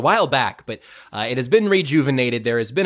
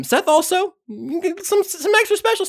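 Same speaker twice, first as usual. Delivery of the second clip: high sing-song. Acted. Teasing plug for Seth's Substack.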